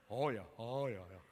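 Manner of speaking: very low, soft dog voice; low, muted cartoon dog; dopey dog. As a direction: answer low and quiet as a trusting dog. Dog answers the scheming cat.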